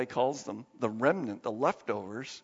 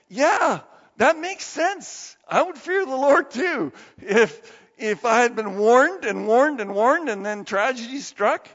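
high, loud, insistent agreement; emphatic agreement; 6 semitones higher, 7 dB louder than usual. Acted. Preacher agreeing he too would fear the Lord, emphatic. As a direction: exclaim it as obvious, pitched up and forceful.

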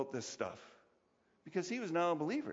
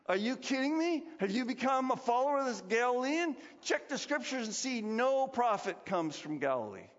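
raised, scornful ridicule; mocking priests; 6 semitones higher than usual. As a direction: scoff at him like indignant elders. Sanhedrin ridiculing Nicodemus.

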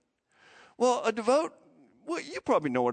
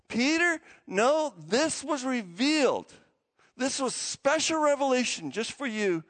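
insistent Jesus; 6 semitones higher than usual. Retold or imitated. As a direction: quote him insisting, pitch lifted. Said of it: "Jesus insists".

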